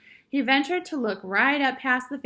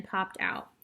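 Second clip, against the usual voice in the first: ordinary delivery, nothing marked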